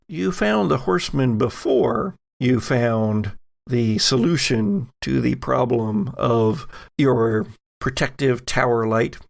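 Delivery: ordinary delivery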